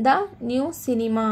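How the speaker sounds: very slow, word by word; slow model reading. Shown as a demonstration. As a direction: read it out slowly for learners. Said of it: "teacher models example".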